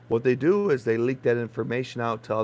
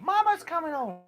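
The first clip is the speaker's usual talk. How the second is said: much higher pet-talk voice; sing-song call to the dog; 14 semitones higher than usual. Acted. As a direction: call it up high like to an excited puppy. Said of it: "she goes absolutely insane when I say".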